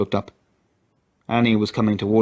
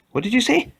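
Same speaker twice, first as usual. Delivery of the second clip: much higher, threatening disbelief; menacing challenge. A narrator's reading. McGrath stepping forward on Lloyd, menacing. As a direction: dare him to repeat it.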